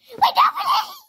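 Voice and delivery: high wailing cry; desperate wail. Acted. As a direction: beg for mercy in tears. Raccoon begs to be freed.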